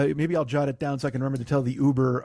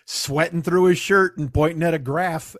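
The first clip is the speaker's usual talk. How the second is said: ordinary delivery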